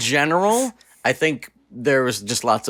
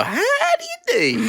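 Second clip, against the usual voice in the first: high, slow, laughing cowboy How do you do; hammed-up cowboy; slower than usual. Shown as a demonstration. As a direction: play a goofy cowboy greeting. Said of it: "You could go".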